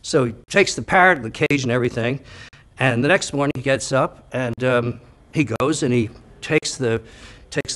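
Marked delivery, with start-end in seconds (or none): none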